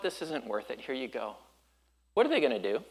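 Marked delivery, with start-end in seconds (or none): 0.0-1.4 resigned giving up. Retold or imitated. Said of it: "you're like".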